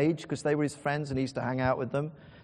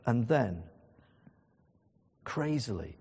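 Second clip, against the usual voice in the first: ordinary talk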